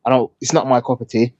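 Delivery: ordinary delivery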